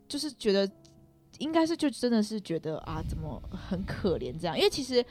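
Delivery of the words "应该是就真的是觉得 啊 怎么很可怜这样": ordinary delivery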